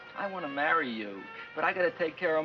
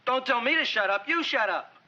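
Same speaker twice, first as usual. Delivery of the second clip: loud, higher furious retort; angry shout; 6 semitones higher, 4 dB louder than usual. Acted. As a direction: snap back in a rage. Seymour shouting back at plant.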